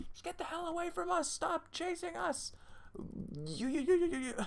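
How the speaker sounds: a high-pitched squabble; squeaky shouting character. Acted.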